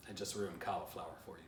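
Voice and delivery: usual speaking voice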